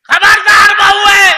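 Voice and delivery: खबरदार shouted high and loud; hammed-up protective warning, खबरदार at full volume; dramatic warning shout. Shown as a demonstration. Mother-in-law shouting खबरदार at her son to protect the bride. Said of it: "देखिए बुढ़िया का drama".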